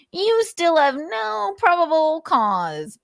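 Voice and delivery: high, slow mocking voice; Nick impression. Narrator voicing Nick's stock reply, high and slow. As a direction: drag it out in a squeaky sneer. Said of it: "Nick's impression".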